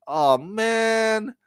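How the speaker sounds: high, drawn-out lament; mock wail of disappointment; dismayed. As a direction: retell your dismay in a high, stretched whine. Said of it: "I was like".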